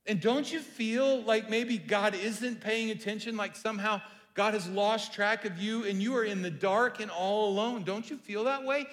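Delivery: rising urgent preaching. Acted. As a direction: press the congregation with feeling.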